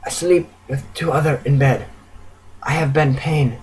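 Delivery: soft, halting broken English; frail, subdued confession; sympathetic, pitiful. A narrator's reading. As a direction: confide it weakly, phrase by phrase. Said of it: "She rather sympathetically says".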